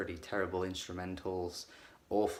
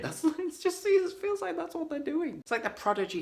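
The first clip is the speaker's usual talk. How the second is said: much higher, laughing through the words; 3 dB louder than usual. Real, not acted. Reviewer laughing at the band's attitude, giddy. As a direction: crack up at the joke just made. Laughing at own mock quote.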